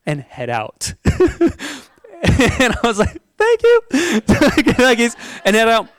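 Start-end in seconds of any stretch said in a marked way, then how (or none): 0.1-0.9 slow, softer, lower dismissive mother; curt mom. Retold or imitated. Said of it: "not the most pleasant woman". 3.4-3.8 much higher, louder squeaky thank-you; relieved thanks. Retold or imitated. Relieved after getting in.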